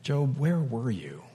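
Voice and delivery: quiet, tender asking of "Job, where were you?"; gentle question. Shown as a demonstration. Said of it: "And did he say".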